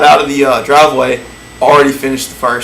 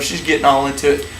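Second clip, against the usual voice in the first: ordinary delivery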